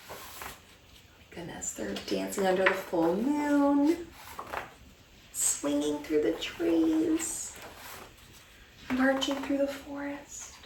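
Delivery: soft, breathy awed aside; hushed wonder. Acted. Teacher hushing in wonder at the dancing monsters. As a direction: marvel at the rumpus in a hush.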